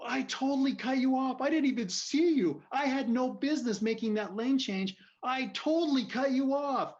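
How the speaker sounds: high, loud re-enacted confession; yelled apology. Driver owning up to the angry man, yelling. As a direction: yell a full admission of fault as if arguing. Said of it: "I start yelling at him".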